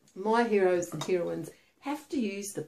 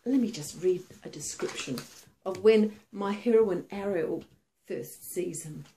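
plain reading voice

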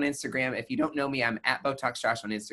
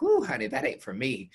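sassy honey; 8 semitones higher than usual. Retold or imitated. Sassy retold reaction to critical care.

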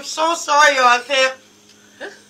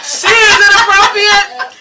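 laughing; 8 semitones higher, 8 dB louder, slower than usual. Real, not acted. Teasing friend's cheeky remark.

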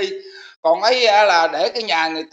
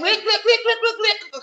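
laughing mock glance; 12 semitones higher than usual. Mocking the man's glances, laughing.

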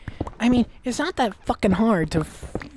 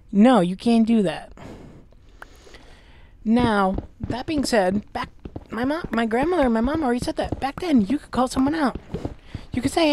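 usual speaking voice

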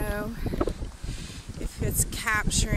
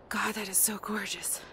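whispering; 2 semitones lower, 12 dB quieter than usual. Real, not acted. Awed aside at the scenery.

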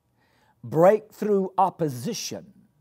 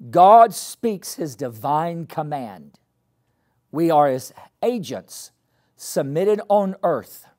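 preaching; 7 dB louder than usual. Acted. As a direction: proclaim it to the whole church. Preaching repeated.

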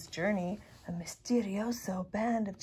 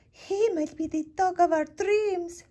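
dreamy; 10 semitones higher, 5 dB louder than usual. A narrator's reading. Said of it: "whispered Rosalita".

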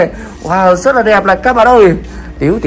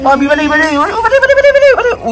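rising to a high excited call; 10 semitones higher than usual. Real, not acted. Urging child to catch fish.

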